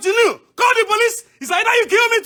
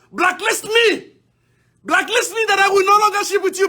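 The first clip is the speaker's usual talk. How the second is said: normal talking voice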